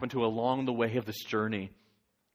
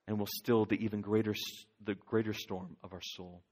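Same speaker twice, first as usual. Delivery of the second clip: ordinary talk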